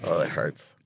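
very soft, low pretend hurt; hushed, playacted suffering; small mock whimper of pain. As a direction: mutter it like a pitiful, sore kid. Acting a teen sore with rashes.

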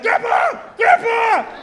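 high, loud yell for grandpa; screaming for help. Retold or imitated. Child calls grandfather as the mower stops, high urgent scream. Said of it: "I am screaming for my grandfather".

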